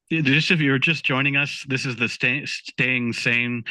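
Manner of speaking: normal talking voice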